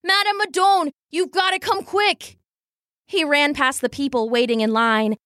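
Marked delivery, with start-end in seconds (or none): 0.0-2.4 high, pressing shout; boy urging her to come quick; urgent call. Acted. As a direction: call her over in a hurry. Boy calling for help.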